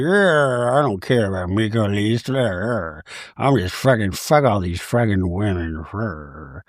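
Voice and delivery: very low mock growling grumble; Meredith, grumpy. Sourpuss Meredith complains about everyone, mock grumpy. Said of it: "She's just a grumpy Gertie".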